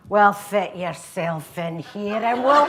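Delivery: slow, loud Glaswegian chat-up; Glaswegian accent. Acted. Guy invites girl onto dance floor, Glaswegian accent. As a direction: draw it out in broad Glaswegian. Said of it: "I go into the accent".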